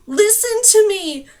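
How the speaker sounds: loud, high, slow plea; pleading cry to the creatures; pleading shout. A narrator's reading. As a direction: beg with everything you have.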